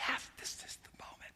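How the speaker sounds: unvoiced hushed whisper. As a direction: breathe it with no voice. Men whisper in cave.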